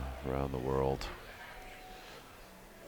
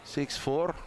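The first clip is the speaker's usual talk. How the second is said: put-on Russian accent; 8 semitones higher than usual. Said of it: "commentate this match in a Russian accent".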